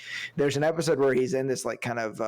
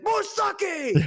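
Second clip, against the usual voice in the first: very high mock shout for more; samurai demand; 3 dB louder than usual. Samurai slams bottle down, hammed-up shouted demand. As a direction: bellow the order like a drunk warrior. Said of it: "He's like".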